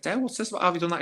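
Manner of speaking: can't believe the cheek; disbelief. Retold or imitated. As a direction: runners shocked to find Dale already there.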